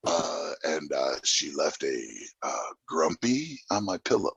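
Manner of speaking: low, quiet mumbling Depp impression; Johnny Depp's odd accent. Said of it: "and with that weird accent".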